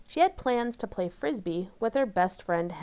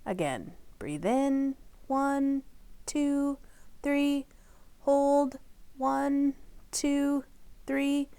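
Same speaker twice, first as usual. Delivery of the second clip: gentle counted breathing guide; soft counting for a child's breath; breathing exercise count; 6 semitones higher, 5 dB quieter, much slower than usual. Acted. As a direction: count the inhale softly and evenly.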